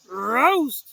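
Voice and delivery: loud, high Scooby yelp; Scooby cries out at the crash; scared shout. Acted. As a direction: yell it in a fright. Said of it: "shouted Scooby".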